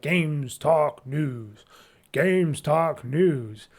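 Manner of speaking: slow, drawn-out robotic announcement. Shown as a demonstration. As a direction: imitate an old robot-voiced jingle, stretching each word. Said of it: "I did that whole robot voice".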